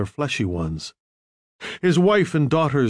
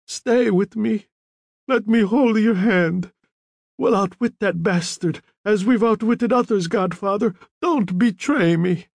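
high-pitched dying man's plea; cling to your friend and beg; urgent, fearful appeal; anxious pleading; 9 semitones higher than usual. A narrator's reading. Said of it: "as if fearing the Don would be offended".

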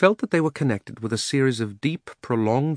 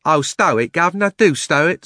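loud, exasperated Cockney cabby; 6 semitones higher, 6 dB louder than usual. A narrator's reading. Cabby interrupting the uncle's rant, exasperated plea. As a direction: cut in, high and fed up. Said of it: "said the cabby".

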